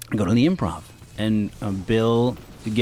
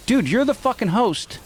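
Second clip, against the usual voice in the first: much higher, heated telling-off; angry scolding; 12 semitones higher than usual. Retold or imitated. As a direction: scold the host hard. Yelling at host on stage.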